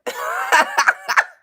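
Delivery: loud, high cackle; high-pitched guffaw; bursting out laughing. Real, not acted. Bursts out laughing at own story.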